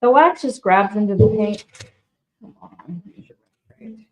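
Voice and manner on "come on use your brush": barely voiced whisper to herself; muttering to self. Real, not acted. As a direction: whisper it under your breath while working. Muttering to herself while working.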